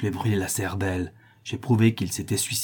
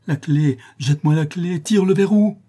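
higher and louder, building to a cry; frantic pleading; 8 semitones higher, 6 dB louder than usual. Acted. The notary begs Cécilie for the key, frantic. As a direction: beg for the key in a rising frenzy. Notary begging her to unlock.

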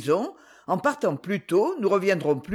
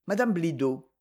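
usual speaking voice

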